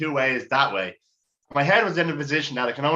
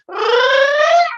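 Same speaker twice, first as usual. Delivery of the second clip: very high, loud, drawn-out "oh"; a long excited squeal; excited burst; 11 dB louder than usual. Retold or imitated.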